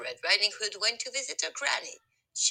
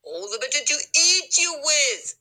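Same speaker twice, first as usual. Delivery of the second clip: loud wolf shout; 6 semitones higher, 7 dB louder than usual. Acted. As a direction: bellow it as the hungry wolf. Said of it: "shouted the wolf".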